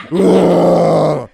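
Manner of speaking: booming, drawn-out whoa; loud whoa. Acted. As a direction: blast out a huge whoa. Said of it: "Oh, sorry, everybody".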